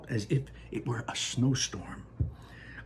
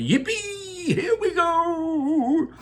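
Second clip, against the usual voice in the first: very high, slow thrilled whoop; shouted yippee; much slower than usual. Acted. Grandpa Joe riding the plunging elevator, whooping with joy. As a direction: whoop like on a roller coaster drop. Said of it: "Grandpa Joe shouted".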